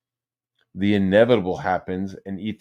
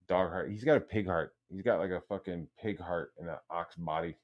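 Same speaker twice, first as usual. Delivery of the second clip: ordinary talk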